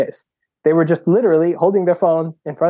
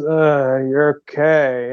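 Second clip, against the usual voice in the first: put-on dazed kid; much slower than usual. Dazed kid voiced in story.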